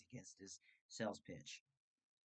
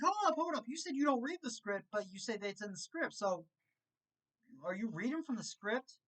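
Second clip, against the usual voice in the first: incredulous callout; 9 semitones higher than usual. Retold or imitated. Catching the salesman in a lie, high and indignant. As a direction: pounce on the lie in disbelief.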